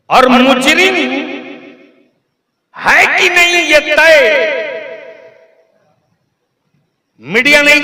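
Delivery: high, loud rally declaration; emphatic oratory. Real, not acted. Orator tells crowd who will not judge, emphatic oratory. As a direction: ring out each refusal to the crowd.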